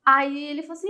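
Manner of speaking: ordinary talk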